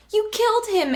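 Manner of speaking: high, shrill-pitched screech. A narrator's reading. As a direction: screech it out at him. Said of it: "Annie screeched".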